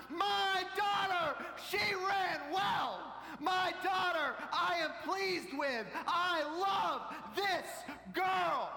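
slow, high, drawn-out proud yell; top-of-the-lungs shout. Retold or imitated. Dad proclaiming love for his last-place daughter, shouting at full volume. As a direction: bellow every phrase for the whole crowd. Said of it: "he shouted at the top of his lungs".

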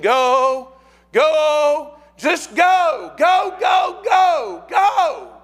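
very high, loud repeated cry; urgent shouting. Acted. People in hell urging the living, urgent shout. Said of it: "people in hell that are saying".

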